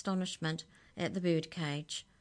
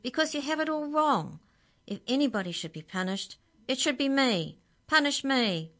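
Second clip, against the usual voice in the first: high, loud, impassioned budgie; high-voiced outburst, insisting loudly; agitated; 9 semitones higher, 8 dB louder than usual. A narrator's reading. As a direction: cry out in frantic protest. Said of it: "In his agitation".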